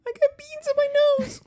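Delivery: put-on very high, tiny child voice; tiny little kid voice. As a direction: squeak it out like a little toddler. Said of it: "she's got a little tiny person".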